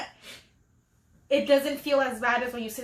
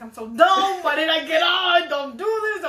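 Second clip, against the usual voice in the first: self-scolding yell; 3 semitones higher, 6 dB louder than usual. Retold or imitated. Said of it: "I'm like".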